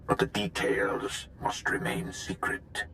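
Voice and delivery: hushed, secretive whisper; conspiratorial whisper. Acted. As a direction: drop to a whisper to keep it secret. Secret plan, hushed aside.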